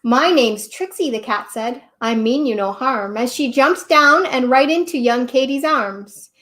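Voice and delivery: put-on lively, loud storybook reading to kids; story narration. A narrator's reading. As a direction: read the tale out big for the children.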